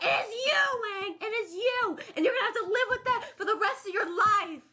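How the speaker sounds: high cackling witch voice, loud; Wicked Witch voice. Acted. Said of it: "you sound like the Wicked Witch".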